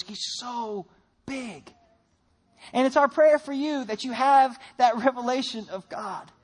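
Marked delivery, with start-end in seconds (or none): 0.0-1.7 hushed awe. Acted. Awe at God's size.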